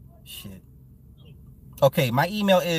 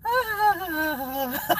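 wife's high laughing sigh; 14 semitones higher than usual. Retold or imitated. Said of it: "the wife is just like".

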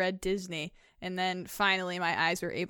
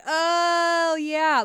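sudden realization; 7 semitones higher, 4 dB louder than usual. Retold or imitated.

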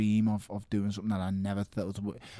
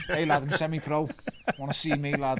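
lightly voiced cocky lad, pitched up; higher-pitched mock bragging; cocky amateur boxer; 6 semitones higher than usual. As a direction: call out a boast, higher voice. Cocky amateur boast acted out.

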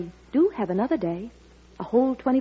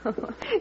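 high giggle; 10 semitones higher, 8 dB quieter than usual. Acted. Girl laughs at the joke.

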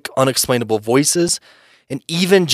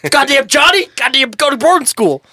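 high, loud furious yelling; angry shouting; 13 semitones higher, 4 dB louder than usual. Pretend. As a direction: bellow at the kids. Joining the mock yelling.

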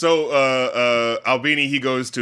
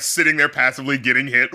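ordinary delivery

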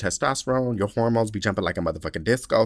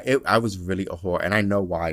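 ordinary delivery

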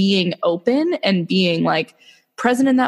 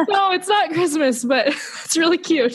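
high, laughing through the words; laughing fondly; 9 semitones higher than usual. Real, not acted. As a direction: mom laughing while telling the cute story. Laughing at daughter's remark.

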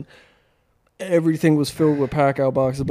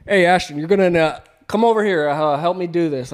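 bossy call; 4 semitones higher, 6 dB louder than usual. Retold or imitated. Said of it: "be like".

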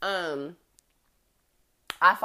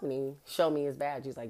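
ordinary delivery

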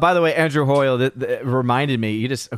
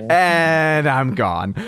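drawn-out, higher mock sign-off; imitated sign-off; 4 semitones higher, much slower than usual. Mimicking a friend dropping off the stream, drawn-out sign-off. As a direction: stretch it out slowly as an exit line. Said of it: "He's like".

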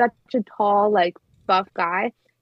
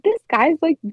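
higher and louder, mock realization; retold amazement; 8 semitones higher, 4 dB louder than usual. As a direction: build up to starstruck surprise. Realizing the passenger is famous.

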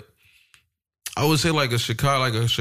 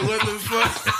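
mock shock; 10 semitones higher, slower than usual. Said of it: "I was like".

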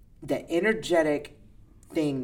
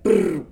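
mock rumble noise; 6 semitones higher, 10 dB louder than usual. Domino of yelling.

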